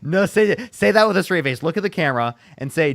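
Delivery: excited, high and loud, laughing challenge. Real, not acted. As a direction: push her, half laughing, amused.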